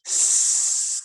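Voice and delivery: long hissed "S", no voice; isolated S sound. Shown as a demonstration. Teacher showing the first sound of slither, hissed S. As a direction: hold "S" as a steady hiss. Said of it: "The first S".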